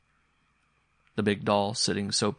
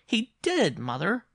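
put-on high, loud little boy protest; high-pitched, emphatic boy; insistent child; 13 semitones higher, 4 dB louder, slower than usual. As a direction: insist to mother like an earnest child. Boy insists to doubting mother.

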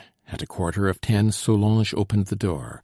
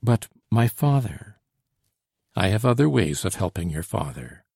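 usual speaking voice

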